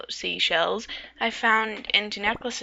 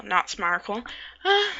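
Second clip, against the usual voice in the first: ordinary delivery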